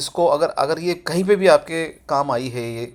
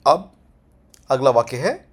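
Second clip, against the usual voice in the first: normal talking voice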